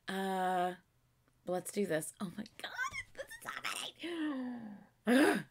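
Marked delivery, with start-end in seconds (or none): 2.2-5.4 hushed start, breaking into a high squeal; real excitement. Real, not acted. Fan about to press play, excited stifled squeal. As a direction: squeak it out, barely containing the excitement. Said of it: "I'm excited".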